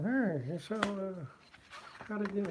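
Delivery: high, put-on voice of an old cartoonist; lightly lampoon how Schulz talked; mock Schulz voice. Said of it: "this is how he talked".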